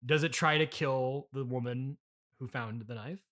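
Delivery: ordinary talk, nothing marked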